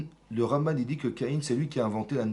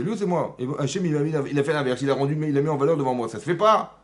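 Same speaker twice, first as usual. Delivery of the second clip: raised, indignant protest; acted frustration; 4 semitones higher, 6 dB louder than usual. Teacher voicing Caïn slighted before his brother, frustrated indignation. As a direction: build to an outraged complaint, louder and higher. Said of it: "La frustration".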